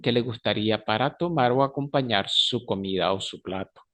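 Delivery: ordinary talk, nothing marked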